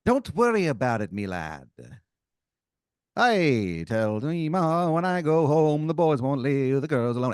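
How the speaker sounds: loud, high stage-Irish brogue, half sung at the end. Acted. Drunk Irish stranger refusing the player's money, hammy brogue. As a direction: belt it out like a tipsy Irish pub regular. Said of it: "He goes".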